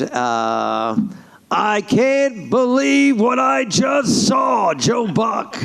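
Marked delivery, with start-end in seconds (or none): 1.5-5.6 high, very slow incredulous call; old-time sportscaster. Shown as a demonstration. Veteran announcer to his booth partner, drawn-out amazement. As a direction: draw out each word in disbelief. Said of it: "all the old guys".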